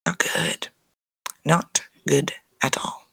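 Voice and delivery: hushed whispered not good; whispering not good to the viewers; whispered aside. Real, not acted. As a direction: whisper it like a dire warning. Unvoiced aside about Whitney.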